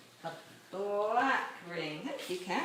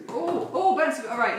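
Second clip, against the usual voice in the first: excited; 4 semitones higher, 6 dB louder than usual. Real, not acted. Ball bounces into cup.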